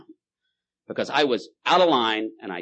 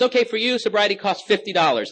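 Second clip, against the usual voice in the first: higher, louder sly salesman God; God the dealer; 10 semitones higher, 5 dB louder than usual. Acted. God naming his price, higher and louder. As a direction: sell it like a street dealer. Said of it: "And God goes".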